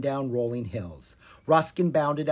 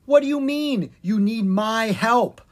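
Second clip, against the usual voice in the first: loud, high, incredulous question; alarmed disbelief; 8 semitones higher, 6 dB louder than usual. A narrator's reading. Prince questions the bard's request for help, incredulous. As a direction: protest in alarmed surprise.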